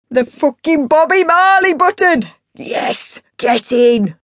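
loud, high triumphant yell; exultant dad. Acted. Dad discovering the reggae button, exultant. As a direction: shout it like a winning goal.

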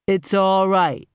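slow, soothing reassurance; calm her down gently, slowly. A narrator's reading. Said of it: "almost soothingly".

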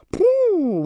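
high, drawn-out phew; loud-breathed, high sigh of relief; relieved phew. Acted. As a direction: let out a long whoo of relief. Boy relieved after near crash.

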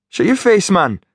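brash high Geordie jibe; thick Geordie voice; Geordie accent. Acted. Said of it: "his thick Geordie accent".

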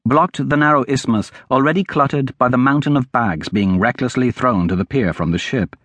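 ordinary delivery, nothing marked

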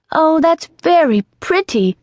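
high, bright delighted exclamation; delighted cry. Acted. Alice cries out in delight to the kitten, high and excited. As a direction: gush with sudden delight. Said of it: "cried Alice".

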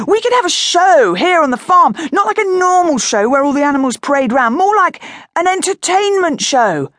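bursting with his idea; excited boy. Acted. Boy pitching a farm show to parents, high and excited.

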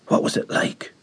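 hushed unvoiced whisper. A narrator's reading. Children whispering over their dead friend. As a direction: breathe the question in a whisper. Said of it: "we whispered".

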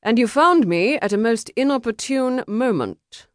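dry. A narrator's reading. Duelist to the man interrupting his duel, dryly. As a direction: answer with dry annoyance. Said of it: "Harrison said dryly".

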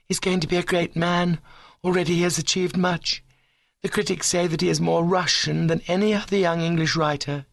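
high, reverent ladylike voice; high-minded girl full of admiration; ambitious young woman, admiring. Acted. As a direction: sing the rival's praises up high.

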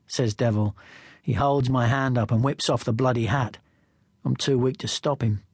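plain reading voice

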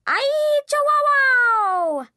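high, loud, drawn-out cry; gecko toy voice. A narrator's reading. Dad shaking stuffed gecko at son, hammy puppet cry. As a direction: stretch it into a long cartoon holler. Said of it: "shook it at me, saying".

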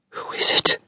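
frightened whisper; hushed scared whisper; whispered cry. A narrator's reading. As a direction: breathe it out in fear. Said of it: "I cried".

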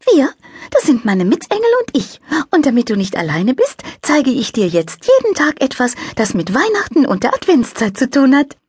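high, light angel voice; little angel voice. A narrator's reading. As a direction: play a cheerful little angel. Angel character voice.

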